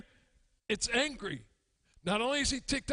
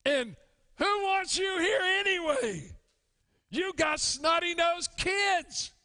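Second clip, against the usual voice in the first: raised, higher, indignant scolding; indignant churchgoer; 3 semitones higher than usual. Acted. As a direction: snap at the newcomers as a jealous old member. Old member scolds newcomers.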